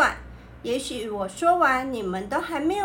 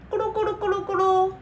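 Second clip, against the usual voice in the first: tumbling sound effect; 6 semitones higher, much faster than usual. Pretend. Tumbling down the stairs.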